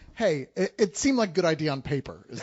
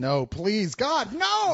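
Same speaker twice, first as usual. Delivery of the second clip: pleading outburst; 3 semitones higher, much slower than usual. Real, not acted. Critic begging the studio for no sequel, mock-horrified cry. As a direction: beg them not to make another one.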